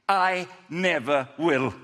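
slow, emphatic cocky vow; drawn-out boast. Acted. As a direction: declare it slowly, full of self-belief. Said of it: "He's so cocky".

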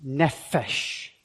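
Nephesh with every consonant exaggerated; over enunciating. Shown as a demonstration. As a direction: hit each consonant of Nephesh hard. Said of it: "really exaggerating the, the consonants".

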